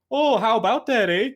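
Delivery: high-pitched, exaggerated Canadian accent; uber Canadian accent. Caster demonstrating the generic Canadian accent, hammed-up uber Canadian. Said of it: "they sound like uber Canadian".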